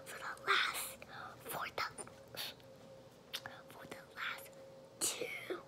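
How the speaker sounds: hushed whisper, hiccups breaking in. Real, not acted.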